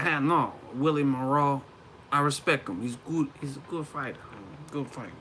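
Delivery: lightly imitated Kazakh accent; Golovkin. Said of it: "He's like".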